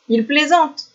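high, lightly acted child's voice. A narrator's reading. Child saying the hunter is joking, high voice. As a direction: pitch it up like a child. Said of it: "fit un enfant".

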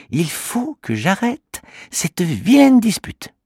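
high small voice, slow and determined; resolute little creature. Acted. Kimamila deciding to end the quarrel, slow and determined little voice. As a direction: say it slowly, set on stopping the fight. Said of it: "dit le petit bonhomme".

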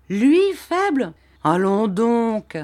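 high, slow outraged Barberin, far from the narrator; indignant protest. A narrator's reading. Barberin scoffing at the old man's doubt, indignant and slow. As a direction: scoff at the very idea, drawn out.

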